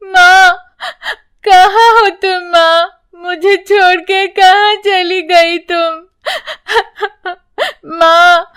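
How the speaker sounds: put-on high, wailing sobs of a child; a child wailing alone; crying loudly. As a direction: sob and wail for mother. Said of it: "जोर-जोर से रोने लगती है".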